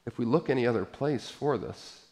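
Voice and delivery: ordinary talk, nothing marked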